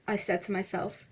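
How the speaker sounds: ordinary delivery, nothing marked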